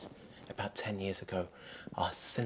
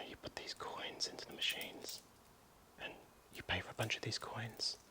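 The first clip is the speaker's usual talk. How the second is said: hushed whisper, sneaking around; breathy secret whisper; 3 semitones higher, 8 dB quieter than usual. Real, not acted.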